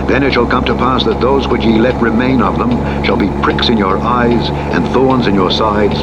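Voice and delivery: ordinary talk, nothing marked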